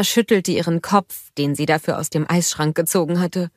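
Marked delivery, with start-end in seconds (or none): none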